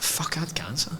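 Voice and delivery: hushed, breath-only disbelief; whispered shock; whispered realisation. Retold or imitated. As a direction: whisper it as a stunned thought to yourself. Retold private realisation of illness.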